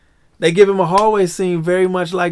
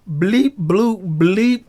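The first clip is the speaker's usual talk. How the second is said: raised-pitch bleeps and bloops; imitated droid beeps; 4 semitones higher, 4 dB louder, much slower than usual. Fan imitating R2-D2 talking to Baby Yoda, droid beeps. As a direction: mimic a chattering droid. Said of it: "talking to Baby Yoda".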